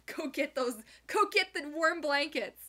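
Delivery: the dog begging for warm blankets; voicing the dog. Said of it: "he's like".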